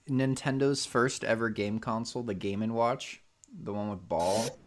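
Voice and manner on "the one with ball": ordinary talk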